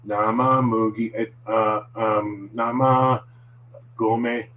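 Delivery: halting tongue-twister attempt with fillers; faltering, drawn-out stop-and-start; stumbling, hesitant. Shown as a demonstration. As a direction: get stuck between words and grope for the next. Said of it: "if you find yourself going".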